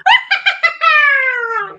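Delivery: high, long falling cackling wail; witch's wail. A narrator's reading. Leading children in the witch's wail, high wailing cry. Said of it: "Let's hear your witch's wail".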